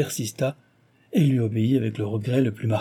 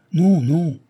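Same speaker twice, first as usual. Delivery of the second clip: agitated protest, high and loud; loud, alarmed refusal; 6 semitones higher, 6 dB louder than usual. A narrator's reading. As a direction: protest urgently, pitched up. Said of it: "l'agitation égalait presque la sienne".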